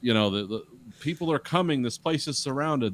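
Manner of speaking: playing at authority, pitched high; commanding voice. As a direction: imitate someone taking charge, starting loud and easing off. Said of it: "in this commanding voice".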